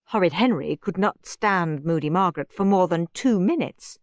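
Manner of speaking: ordinary delivery, nothing marked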